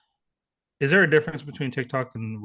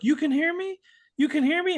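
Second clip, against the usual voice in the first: much higher imitated wife, questioning; amazed wife; 14 semitones higher than usual. Imitating a wife to her newly hearing husband, amazed. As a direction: voice a disbelieving wife in a raised pitch.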